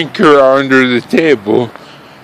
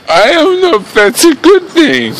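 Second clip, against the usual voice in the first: tipsy, higher voice; 12 semitones higher than usual. Real, not acted. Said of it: "I'm drunk".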